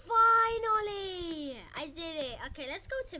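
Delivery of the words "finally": high, loud, slow drawn-out cry; triumphant relief. Real, not acted. Gamer finally winning the fight, loud drawn-out relief. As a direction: stretch it out in victorious relief.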